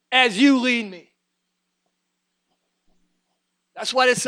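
high, loud, driving sermon delivery; full-force preaching. Real, not acted. Preacher telling friends God is killing the old nature, impassioned preaching. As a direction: preach it with urgent, mounting force.

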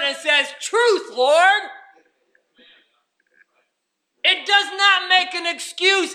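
loud, high, shouting preacher; fiery revival shout; full-force preaching. Acted. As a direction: drive it home to the congregation.

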